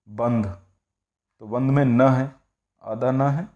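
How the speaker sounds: usual speaking voice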